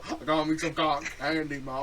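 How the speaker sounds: teasing Deep South carnival-goer imitation; mock southern accent. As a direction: ham up a southern accent to tease. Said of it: "sound like you were from the deep south".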